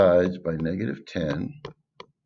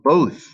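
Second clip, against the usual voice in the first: both punched high and loud; stress on both; 7 semitones higher, 7 dB louder than usual. Shown as a demonstration. Teacher hammering the key word both, loud and high. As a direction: hit the word both hard, higher and louder. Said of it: "here's the key word".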